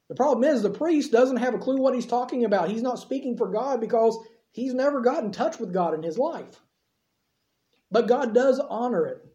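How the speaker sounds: ordinary delivery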